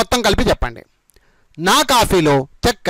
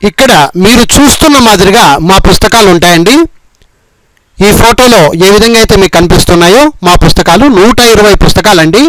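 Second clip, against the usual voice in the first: loud, punchy ad read; sales pitch; 9 dB louder than usual. Acted. Teacher advertising his book set to student viewers, loud sales pitch.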